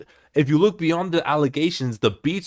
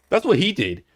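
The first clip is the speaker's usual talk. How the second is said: ordinary talk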